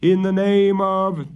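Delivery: high, loud solemn intonation; intoning prayer. A narrator's reading. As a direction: intone the banishing rite. Prior intoning a banishment.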